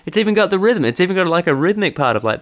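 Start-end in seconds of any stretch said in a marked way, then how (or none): none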